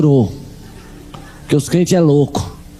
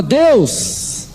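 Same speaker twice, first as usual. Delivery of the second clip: high, slow plea to God; mock cry out to God in her voice; mother crying out; 7 semitones higher, much slower than usual. Preacher retells his mother shouting at God, high and slow. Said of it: "E ela gritava".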